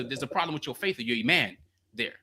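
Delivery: ordinary delivery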